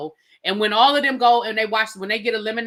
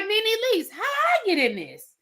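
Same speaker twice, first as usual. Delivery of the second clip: high, bewildered put-on voice; mock baffled whine, slowed at the end; imitating Mackenzie; 11 semitones higher, slower than usual. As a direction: squeak it out like a confused young woman. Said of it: "She was like".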